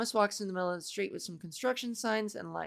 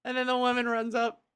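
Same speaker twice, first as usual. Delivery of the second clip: usual speaking voice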